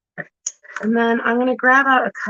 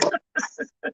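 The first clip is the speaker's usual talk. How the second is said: breathy laugh; 5 dB quieter than usual. Real, not acted. Laughs at own slip.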